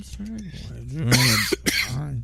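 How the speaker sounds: ordinary delivery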